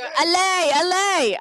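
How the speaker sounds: bright cheering allez allez; chanting allez like a fan. Pretend. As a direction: cheer the crew on in French. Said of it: "So we go".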